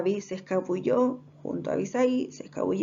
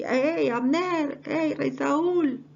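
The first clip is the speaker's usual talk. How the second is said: drawn-out higher-pitched mock shout; calling out; 7 semitones higher, much slower than usual. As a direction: call slowly to someone far away, stretching the names. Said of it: "comenzó entonces a gritarle ahí".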